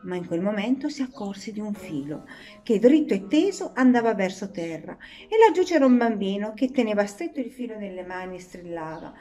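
ordinary delivery

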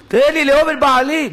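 high, loud imitation of begging; retold desperate plea. Retold woman's desperate plea to God.

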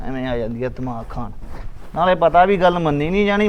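grumbling to himself. Acted. Husband grumbles after wife leaves.

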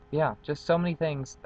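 normal talking voice

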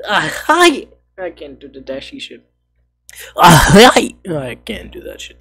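high nerdy voice, loud greeting. Acted.

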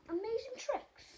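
normal talking voice

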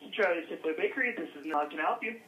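ordinary delivery

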